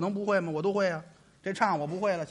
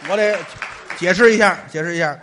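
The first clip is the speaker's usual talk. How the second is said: loud insistence; 10 dB louder than usual. Acted.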